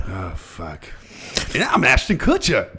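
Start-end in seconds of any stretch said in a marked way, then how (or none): 1.5-2.8 lightly mocked high, loud celebrity voice; Ashton Kutcher. Butting in as a second celebrity host, high impression. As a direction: pop up high as an eager co-host. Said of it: "Why did I throw Ashton Kutcher?".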